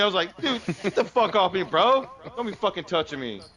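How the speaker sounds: ordinary talk, nothing marked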